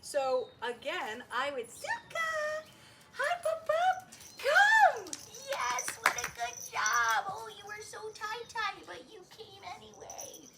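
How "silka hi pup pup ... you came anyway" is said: very high, loud, gushing call to the pup; happy puppy recall. Real, not acted. Trainer calling her puppy across the room, thrilled. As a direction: call her like a party's starting. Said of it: "I sound really happy".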